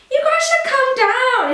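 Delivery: very high, slow retold call. Guest inviting models down from balcony, high call. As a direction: call invitingly to people upstairs.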